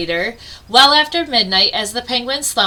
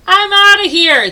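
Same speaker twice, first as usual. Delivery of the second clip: much higher, louder frantic yelp; yelping bear; 9 semitones higher, 9 dB louder than usual. Acted. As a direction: bolt away in a panic.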